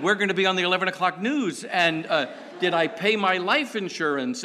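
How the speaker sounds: fast, loud, high mock alarm; mock panic. Passengers fearing the worst, fast mock panic. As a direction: rattle it off in a comic panic. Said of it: "We're thinking".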